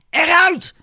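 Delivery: very high-pitched furious yell; angry shout. A narrator's reading. Father throwing the aunt out, shouting angrily. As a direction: order her out with a sharp cry. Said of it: "schreeuwde hij tegen de vrouw".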